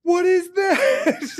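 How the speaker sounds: stretched-out, high, loud baffled question; slow astonished gasp at something absurd; baffled what. Retold or imitated. Friends stunned by a goblin on a head, drawn-out amazed question. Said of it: "And we were all like".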